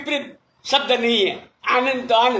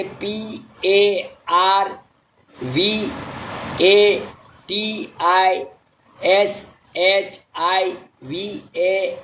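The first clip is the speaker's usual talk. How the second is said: letters read one by one, slowly; careful slow spelling of a name; 3 semitones lower, much slower than usual. Shown as a demonstration. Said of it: "मैं spelling पढ़ लेता हूँ sir".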